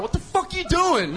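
high, slow mock outrage from the pet shop owner; a hammed-up shopkeeper yelling what the hell; mock angry owner. Pet shop owner catching a customer at the lizard cage, mock outraged.

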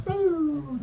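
lighter cartoon zoom-away sound; cartoon boom. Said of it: "Like watching a cartoon now".

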